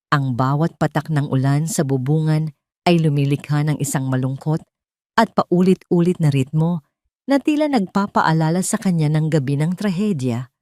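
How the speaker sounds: ordinary delivery, nothing marked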